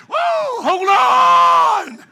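high, loud panicked shout; panicked yell. Retold or imitated. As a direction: stewardess freaking out in turbulence. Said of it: "You see her freak out".

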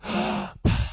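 audible breath added after a word; demonstrated breath. Shown as a demonstration. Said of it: "add something on the end of it".

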